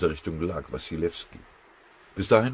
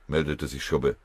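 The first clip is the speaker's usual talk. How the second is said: ordinary delivery, nothing marked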